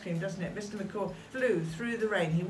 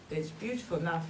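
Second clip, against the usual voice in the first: usual speaking voice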